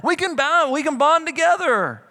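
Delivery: cheering coach calling out; encouraging coach. Retold or imitated. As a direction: pump the player up. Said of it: "a coach that's there teaching you".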